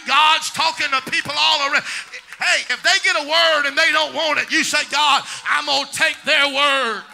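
loud, rising sermon shout over the organ; preaching at full force. Acted. A preacher rallying the church to claim their blessing, preaching at full force.

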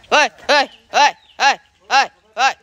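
repeated short shouts to move animals; herding call. Real, not acted. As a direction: drive the pig on with steady, punchy calls. Driving the pig along. (tocando o porco com gritos curtos)

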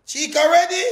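high, loud "Chica ready?" hollered out; loud call. Acted. Calling out to Chica at the party, loud and high. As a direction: hype her up loudly. Quiet then loud repeat.

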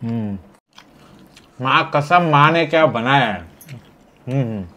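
chewing while talking; mouth full. Real, not acted. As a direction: food in mouth. Seen 0: food visible in open mouth while talking after a bite.

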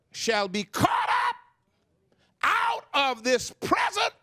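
high, shouted and drawn-out preaching; preaching at full force. Acted. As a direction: lift the church with each phrase. Preacher building to a climax.

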